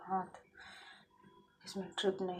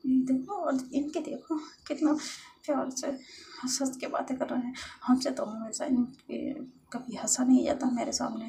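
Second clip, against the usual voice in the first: loud, high, aggrieved complaint; jealous grumbling; 5 semitones higher, 7 dB louder than usual. Acted. Jealous lover watching Lovely laugh with Khushi, jealous grumbling. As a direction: complain bitterly in a raised voice.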